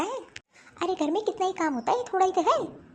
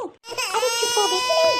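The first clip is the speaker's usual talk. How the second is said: scolding shout; 8 semitones higher, 6 dB louder, slower than usual. Acted. Mother-in-law scolding over crying baby.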